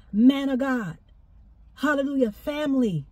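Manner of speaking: normal talking voice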